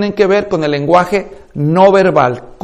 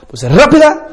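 fast; 7 semitones higher than usual. Shown as a demonstration. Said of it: "La velocidad con la que hablamos".

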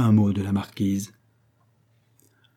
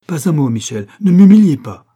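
loud, raised proud plea; wounded pride; 6 semitones higher, 11 dB louder than usual. A narrator's reading. Proud poor cavalier silencing his friend, wounded and loud. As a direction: cut him off forcefully, building to a cry.

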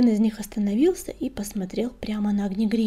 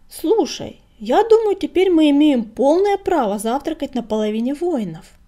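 high, loud, excited pitch; eager proposal; 5 semitones higher, 6 dB louder than usual. A narrator's reading. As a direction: pitch a bold idea to a friend. Playful proposal voiced in story.

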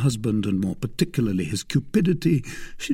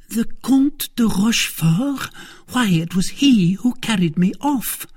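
high, louder, astonished wife; shocked outcry; 13 semitones higher, 5 dB louder than usual. Acted. Wife recognising the name of her abductor, shocked. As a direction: exclaim it in outraged recognition.

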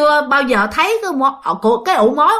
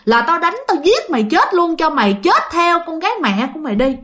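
higher-pitched acted father's tirade; storyteller's mock cursing, pitched up without shouting; angry scolding; 4 semitones higher than usual. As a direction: berate the children as an enraged father. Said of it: "vừa đánh vừa chửi".

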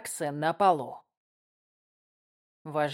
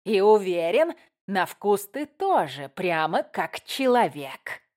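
slow, louder sneering goblin; red cap voice; 4 dB louder than usual. Acted. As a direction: taunt like a leering goblin. Red cap leader threatening.

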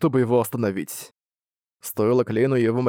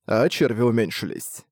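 plain reading voice

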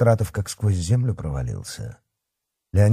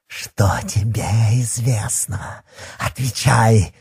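slow, hissed demand; menacing interrogation; slower than usual. A narrator's reading. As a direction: interrogate with a drawn-out hiss, then snap the order. Werewolf interrogates cowering fat man.

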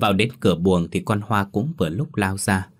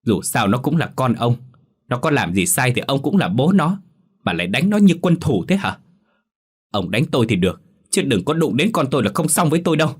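furious retort; 5 semitones higher than usual. A narrator's reading. Mother retorts to husband defending her daughter, furious. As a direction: defend the child hotly. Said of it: "bà máu sáu cơn đáp lại".

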